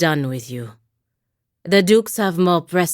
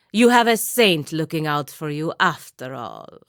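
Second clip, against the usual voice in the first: ordinary talk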